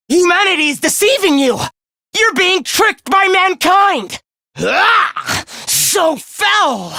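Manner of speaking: high, revolted cries; frantic outrage. Acted. Villain warning foes humanity tricks them, high outraged rant.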